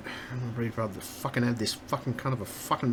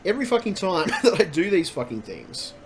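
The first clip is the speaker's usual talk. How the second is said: louder and higher, venting fury at the software; building angry rant; 5 semitones higher, 3 dB louder than usual. Real, not acted. Streamer venting at buggy software to chat, angry rising rant. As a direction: let the frustration boil over into yelling. Streamer ranting at broken programs.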